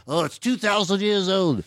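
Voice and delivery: high, put-on pedant correcting him; outraged online commenter. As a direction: jump in with a mock correction. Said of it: "some guy immediately".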